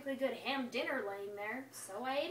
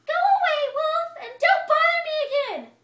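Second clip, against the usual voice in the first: much higher and louder, shouting rudely; rude pig; 14 dB louder than usual. Acted. Rude pig shouts the wolf away, loud and high. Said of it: "rude little porker said".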